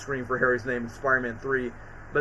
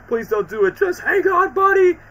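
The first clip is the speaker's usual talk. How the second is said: starts loud, climbs to a high yell; beg, rising into a high loud shout; pleading, building to a shout; 14 semitones higher, 7 dB louder than usual. Retold or imitated. Gamer imitating Peter begging Harry to hang on, building to a high yell. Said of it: "trying to use the anti-venom powers to try to, like, save Harry's life".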